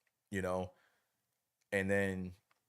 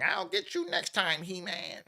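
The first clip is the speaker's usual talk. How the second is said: higher, put-on cartoon villain taunt; Skeletor's parting threat; 10 semitones higher, slower than usual. Skeletor vowing revenge on He-Man, higher mock villain voice. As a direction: sneer it like a beaten villain fleeing. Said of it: "every cartoon ended with Skeletor saying".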